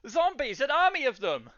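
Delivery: high, loud, urgent warning; alarmed barwoman. Acted. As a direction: cry out the news in a high voice.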